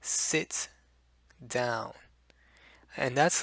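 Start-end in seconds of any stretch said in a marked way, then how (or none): 0.0-1.9 crisp T in "Sit down", each letter clear; "Sit down" with the T fully pronounced; tense, deliberate. Shown as a demonstration. Teacher demonstrating a careful, tense "Sit down". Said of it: "Very deliberate".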